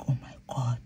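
whispered, low and hushed shock; stunned whisper. Retold or imitated. Passenger reacting to the gun pressed on her, stunned whisper. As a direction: whisper it under your breath, stunned.

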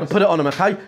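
usual speaking voice

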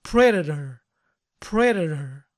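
"Predator" with the T as a quick tap, "prederer"; softened T. Shown as a demonstration. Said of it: "pronunciarla de este modo".

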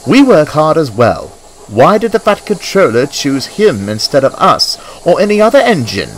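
high, indignant protest; cross. A narrator's reading. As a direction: complain loudly, pitch climbing. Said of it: "added Henry crossly".

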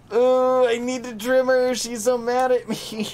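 high, squealing fond coo; high-pitched doting whine; pet-talk coo. Real, not acted. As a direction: gush over a grumpy pet. Cooing over annoyed pet.

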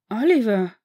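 put-on Harry recognising his old captain, loud surprised cry. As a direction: blurt the name in shock. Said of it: "Vollkommen überrascht".

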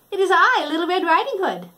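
put-on high, quick girlish answer; cheerful little Red. Red at grandma's door, high and bright.

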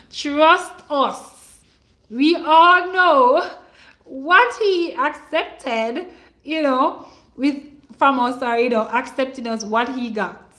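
slow and loud, preaching it out; preaching with fervor. Real, not acted. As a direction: lean into the congregation. Preaching swell.

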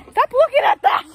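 high, loud telling off; sharp loud scold. Real, not acted. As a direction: snap at him to stop.

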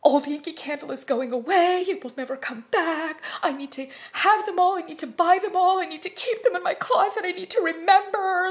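rising to a frenzied buying urge; frantic hoarding thoughts. Retold or imitated. Collector voicing her frantic urge to buy every candle. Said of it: "in that headspace where I'm like".

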